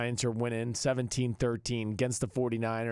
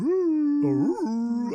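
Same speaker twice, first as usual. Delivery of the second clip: surprised ooh; 13 semitones higher, 5 dB louder than usual. Real, not acted. Reacting to bold prediction.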